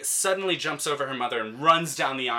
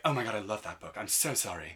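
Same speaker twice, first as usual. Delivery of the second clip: apologetic mother; 6 dB quieter than usual. Retold or imitated. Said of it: "the mother turns around ... is like".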